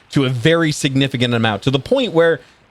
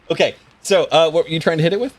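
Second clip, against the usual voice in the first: normal talking voice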